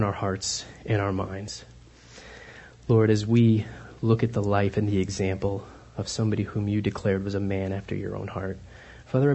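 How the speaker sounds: a hushed prayer to God. Acted. Leads a prayer.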